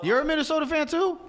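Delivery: high, incredulous question, pitch jumps up; as if he can't believe another fan exists; surprised. Real, not acted. Host surprised co-host likes Minnesota.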